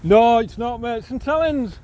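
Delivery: high, loud friendly correction; calling out. Real, not acted. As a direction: call back proudly that you're local. Correcting stranger across distance.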